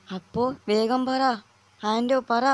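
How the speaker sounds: high, slow, eager urging; eager excitement. Acted. Adam urging his friend to tell the happy news, excited. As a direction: press your friend for the good news. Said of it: "ഇപ്പോഴാണ് എൻ്റെ ശബ്ദം ഉയർത്തിക്കായല്ലോ".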